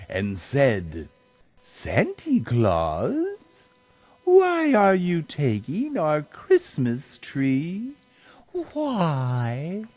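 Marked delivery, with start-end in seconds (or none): 1.6-9.9 put-on higher small child's pleading question; Cindy Lou Who asking the Grinch, high and small; little girl voice. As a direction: ask like a puzzled toddler.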